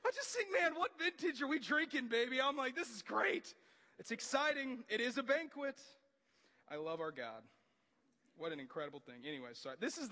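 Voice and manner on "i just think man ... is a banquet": much higher and louder, gushing; excited. Real, not acted. Preacher gushing to the church about heaven's banquet. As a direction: bubble over with joy at the feast.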